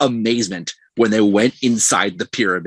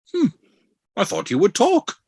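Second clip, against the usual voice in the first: higher, otherworldly god voice, dry and mocking; Khonshu voice; 10 semitones higher than usual. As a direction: deliver it like a cold moon god teasing a victim. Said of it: "and Khonshu goes".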